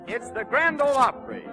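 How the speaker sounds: usual speaking voice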